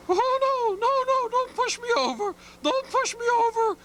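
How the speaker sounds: very high, frightened little egg voice; panicky egg voice. Acted. Egg begs the bear not to push him over, very high and panicky. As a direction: squeal and beg not to be tipped over.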